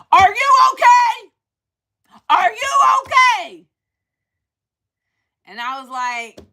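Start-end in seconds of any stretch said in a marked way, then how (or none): 0.1-3.7 much higher, louder call across the street; yelling across the street. Retold or imitated. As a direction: shout it over to a stranger. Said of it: "yells out".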